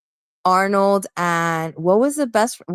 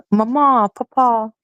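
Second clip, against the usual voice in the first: high, teasing Southern "mama, papa"; swooping mock Southern accent; imitated Southern accent; 5 semitones higher, 4 dB louder than usual. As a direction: mimic the Southern way of saying it. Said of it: "here they say mama and papa".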